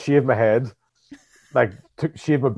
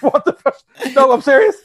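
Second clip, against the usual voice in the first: retold shocked protest; 12 semitones higher, 6 dB louder than usual. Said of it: "And I was like".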